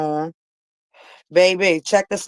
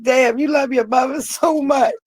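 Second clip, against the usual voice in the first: slow, higher imitated exclamation; drawn-out incredulous cry; mock exclaim; 7 semitones higher than usual. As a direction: ham up the onlookers' amazement. People exclaiming about her love.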